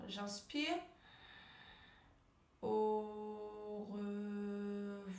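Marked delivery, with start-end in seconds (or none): 2.6-5.2 AU REVOIR very slow, each syllable held on a level tone; stretched syllables. Shown as a demonstration. Coach has learners repeat along, drawn-out AU REVOIR. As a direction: draw out AU, RE and VOIR evenly, almost chanted. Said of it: "allonger le mot, allonger la syllabe".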